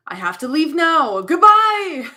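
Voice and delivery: loud, high, overwrought goodbye; upset farewell. Acted. Hurt Clearwing leaving friends, upset. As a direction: build to a wailed goodbye.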